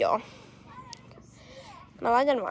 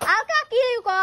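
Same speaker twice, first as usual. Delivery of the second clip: much higher, carrying call; 10 semitones higher, 3 dB louder than usual. Real, not acted. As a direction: pitch it high and call over. Calls out to screamers nearby.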